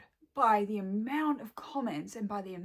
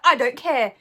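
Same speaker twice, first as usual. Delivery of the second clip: dismissive quote; 12 dB louder than usual. Retold or imitated. Quoting a dismissive woman.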